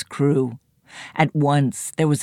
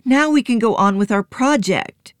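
put-on eager boy; 9 semitones higher, 4 dB louder than usual. A narrator's reading. Joe continues.

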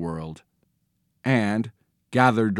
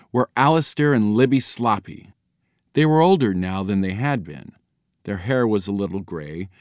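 plain reading voice

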